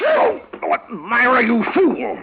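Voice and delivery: high, loud outraged yell; outraged cry. Acted. Invalid uncle to niece who broke his glasses, outraged shout. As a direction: explode at her clumsiness.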